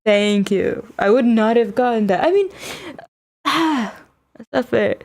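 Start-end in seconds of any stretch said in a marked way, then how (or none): none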